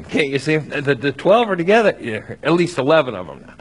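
usual speaking voice

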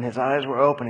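ordinary talk

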